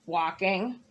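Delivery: low, sulky bird voice; grumpy bird. Acted. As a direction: answer like a sulking bird.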